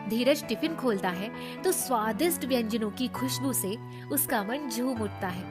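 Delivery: ordinary delivery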